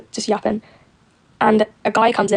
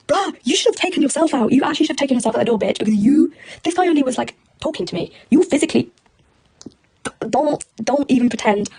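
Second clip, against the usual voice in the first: higher-pitched, exasperated telling-off; indignant rebuke; 5 semitones higher, 3 dB louder than usual. Retold or imitated. Woman calling out a man for his hypocrisy, indignant. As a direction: lay into him with rising indignation.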